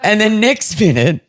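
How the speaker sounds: ordinary talk, nothing marked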